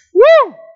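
high, loud "woo"; whooped exclamation. Real, not acted. Preacher cheering passion to the congregation, high whoop. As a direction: let out a sudden high whoop.

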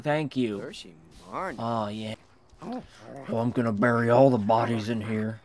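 normal talking voice

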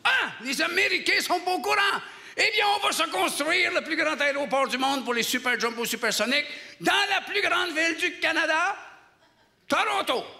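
high, triumphant mock-politician proclamation; excited realization. Minister announces the grand plan to colleagues, high and excited. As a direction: seize on it with rising, hammed-up excitement. Ministers seize on the secret, elated.

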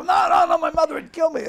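loud, high mock panic; alarmed protest. Comedian refusing his friend's top-end coffin offer, protesting.

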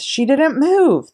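high, loud, slow protest; children rising up against the headmistress; crying out. A narrator's reading. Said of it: "they cried out".

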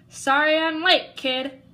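hearty loud greeting. Acted. Gabe arrives loudly.

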